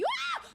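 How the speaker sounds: a short high shriek of fright; startled scream. Real, not acted. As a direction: shriek in sudden alarm. Startled by creature.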